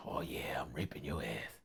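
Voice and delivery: joking creep whisper; mock creepy whisper. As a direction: hiss it like a sleazy creep. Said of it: "I'm like this".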